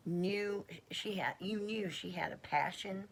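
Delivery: normal talking voice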